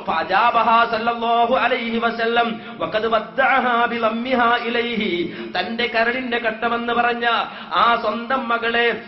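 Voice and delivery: rising, tearful sermon cadence; impassioned preaching. Acted. Preacher retelling the Prophet's death to the congregation, impassioned. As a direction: preach the deathbed scene with mounting feeling.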